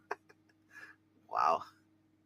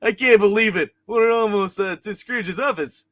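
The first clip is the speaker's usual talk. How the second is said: higher, louder voice for an excited figure; lightly put-on voice, pitched up in disbelief; corrected character voice; 4 semitones higher, 4 dB louder than usual. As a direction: redo it in a raised character voice. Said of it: "that's the wrong voice for him".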